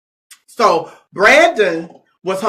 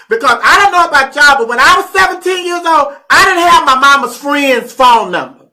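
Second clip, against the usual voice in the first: heated rant; 3 semitones higher, 4 dB louder than usual. Real, not acted. Heated rant about raising child.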